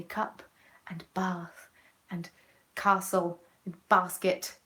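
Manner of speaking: bath, castle and basket with long southern ah; spaced-out word list in a southern English accent; southern English pronunciation. Shown as a demonstration. Southerner showing her own way of saying it, southern accent. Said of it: "'cause I am Southern and I say".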